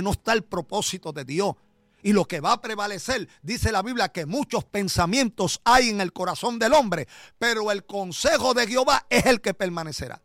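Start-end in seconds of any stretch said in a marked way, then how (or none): 2.0-10.2 loud, high, driving sermon climax; full-force preaching. Acted. Pastor exhorting the congregation, loud, high preaching. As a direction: preach it hard to the back pews.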